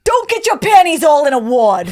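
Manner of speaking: loud, put-on Boston accent. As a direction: lay on the Boston accent on request. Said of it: "Say that again in that accent again".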